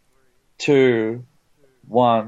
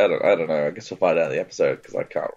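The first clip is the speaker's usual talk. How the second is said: usual speaking voice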